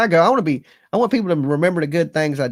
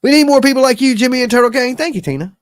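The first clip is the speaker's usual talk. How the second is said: much higher and louder, bursting with thanks; excited shout-out; 11 semitones higher, 7 dB louder, faster than usual. Real, not acted. Streamer shouting thanks to chat, excited and loud. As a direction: call it out to chat like you just lit up.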